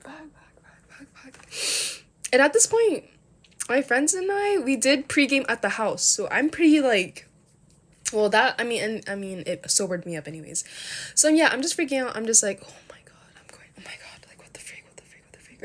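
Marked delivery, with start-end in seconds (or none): 0.0-2.0 string of curses whispered under breath; panicked whispered cursing. Retold or imitated. Freaking out to herself about the cops, whispered curses. 12.6-15.6 breathless whispered repetition; panicked whisper. Retold or imitated. Freaking out silently while security surrounds her, whispering. Panicking inside, repeated exclamations.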